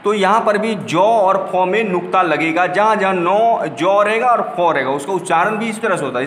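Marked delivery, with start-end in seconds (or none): none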